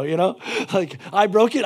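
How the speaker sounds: usual speaking voice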